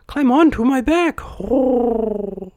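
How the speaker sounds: high bird voice with long hoot; pigeon. A narrator's reading. Pigeon character speaks.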